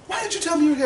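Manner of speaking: high, loud imitation of a mother confronting; upset mom's demand. Mother confronting son, acted.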